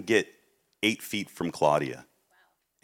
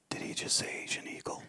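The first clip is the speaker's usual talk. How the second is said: hushed unvoiced whisper, softer; 10 dB quieter than usual. Whispering to his sister during the Zoom call. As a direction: secretly whisper to the person beside you.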